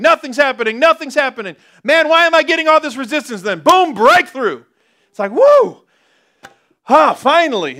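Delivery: high, loud building to a joyful woo; excited. Acted. Preacher acting a believer's breakthrough, excited. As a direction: act out frustration bursting into relief.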